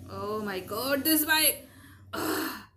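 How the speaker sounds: real exasperation. Real, not acted. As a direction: break off, fed up with the noise. Interrupted by a noisy bike outside.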